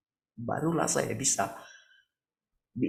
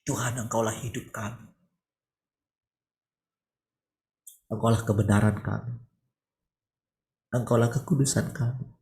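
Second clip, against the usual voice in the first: closing prayer. Real, not acted. Preacher leading the congregation in a closing prayer, reverent.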